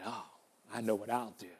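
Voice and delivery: low-key, hushed plotting aside; the wicked neighbour plotting softly to himself; quiet scheming. Acted. Wicked man hatches his scheme.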